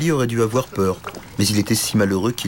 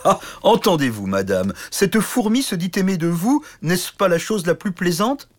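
mocking laughter; 10 semitones higher than usual. A narrator's reading. Giant knight to princess, mocking the little knight. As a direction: laugh at the tiny suitor. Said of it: "éclata de rire".

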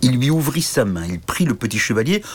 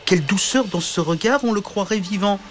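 higher, dreamy admiring voice; admiring princess; 10 semitones higher than usual. Acted. Princess admires the tiny knight, higher and dreamy. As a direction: marvel over a pretty ornament.

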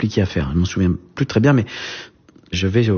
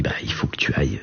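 soft hushed whisper; whispered reply; 8 semitones lower, 6 dB quieter, faster than usual. Retold or imitated. A father whispering to his son that he must go.

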